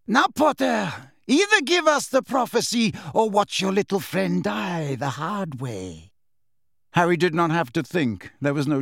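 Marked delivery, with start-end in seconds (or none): 0.1-6.1 Bellatrix's voice. A narrator's reading. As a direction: menace Harry calmly. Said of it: "She turned and gazed".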